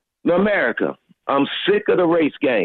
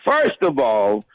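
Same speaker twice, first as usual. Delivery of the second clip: low, mock-Linda delivery; doing a Linda; 12 semitones lower, slower than usual. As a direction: imitate Linda, pitched well down. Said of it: "I gotta do a Linda".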